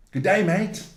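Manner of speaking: playful Australian G'day; Aussie accent. Pretend. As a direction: hail him in a cheery Australian accent. Greeting the Australian player.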